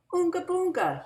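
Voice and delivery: put-on high, loud silly baby talk; big brother voice. A narrator's reading. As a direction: coo nonsense at the baby. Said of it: "he repeated".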